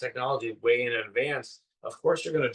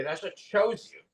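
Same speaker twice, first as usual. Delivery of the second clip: higher-pitched mock English accent; 8 semitones higher than usual. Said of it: "in his English accent".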